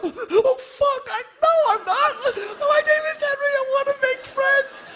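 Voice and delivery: put-on very high, sobbing child voice; crying little kid. Bullied boy crying and insisting he wants friends. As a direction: blubber through the tears.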